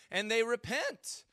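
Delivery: REPENT cracking upward; real accidental puberty squeak; pubescent voice crack. Real, not acted. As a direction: voice breaks high on "repent". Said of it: "Oh, wow, that was like, well, puberty".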